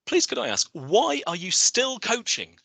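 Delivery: usual speaking voice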